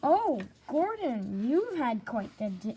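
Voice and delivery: slow mocking sympathy; teasing engine voice. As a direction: tease him with drawn-out pity.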